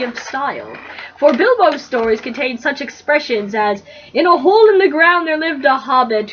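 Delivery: reading the fanfic aloud to her roommate, lightly narrated; easy storyteller read, a touch of mock grandeur; story reading. Reading a parody fanfic to a roommate, mild storybook voice.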